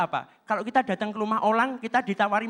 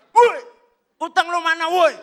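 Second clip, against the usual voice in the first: loud, high debt collector yell; the usual debt collector hollering for his money; rough shout; 7 semitones higher, 9 dB louder than usual. Retold or imitated. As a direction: bark it like a thug collecting money.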